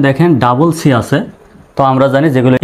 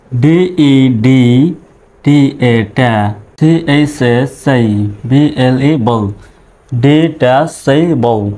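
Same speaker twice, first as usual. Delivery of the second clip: "ডি ট্যা সেই বল" with each syllable set apart; much slower than usual. Shown as a demonstration. Teacher showing learners how to pronounce a big word, syllable by syllable. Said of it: "বড় বড় শব্দগুলো আমরা কিভাবে উচ্চারণ করব".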